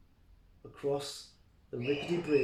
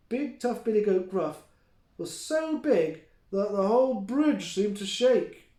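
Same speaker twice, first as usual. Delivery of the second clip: plain reading voice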